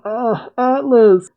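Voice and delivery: high, slow mock wail; crowd's wail, stretched out high; horrified cry. People screaming as their champion explodes, high horrified wail. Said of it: "The people cry out".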